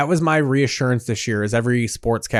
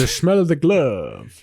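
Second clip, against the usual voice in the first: poetic voice; 3 semitones higher, slower than usual. Retold or imitated. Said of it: "He always speaks in fucking poetry".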